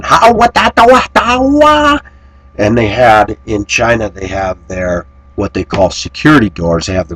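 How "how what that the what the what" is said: high, loud mock chatter of neighbours; noisy Chinese women. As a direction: imitate the shrill-pitched bickering women upstairs. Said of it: "you hear those women".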